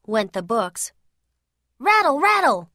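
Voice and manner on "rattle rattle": higher, punchy rattle, rattle!; rattling sound effect. A narrator's reading. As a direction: make the blinds clatter. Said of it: "went the blinds".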